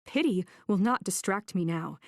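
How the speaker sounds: ordinary delivery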